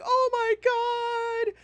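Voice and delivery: hammed-up teary fan excitement; mock fan gushing. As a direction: overcome fan squealing. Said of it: "We're like".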